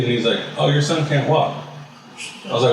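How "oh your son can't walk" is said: higher, offhand mock therapist voice; blunt news tossed off in a higher voice; imitating the therapist.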